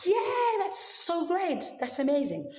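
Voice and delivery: the father laughs at the news his son lives; overjoyed old father. Acted. Father hears Joseph is alive.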